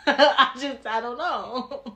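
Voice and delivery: talking through a laugh. Real, not acted. Admitting she can't gauge her aggression, laughing. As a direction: laugh helplessly as you say it.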